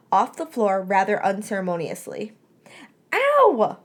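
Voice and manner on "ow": very high, loud yelp; a sharp startled cry; surprised shriek. A narrator's reading. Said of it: "Melody shrieked".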